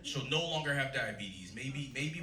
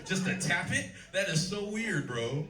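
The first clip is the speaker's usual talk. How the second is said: high, loud incredulous ribbing; loud teasing rant at an audience member; excited rant; 6 semitones higher, 4 dB louder than usual. Real, not acted. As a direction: hammer the joke with disbelief. Ranting at the Magic player.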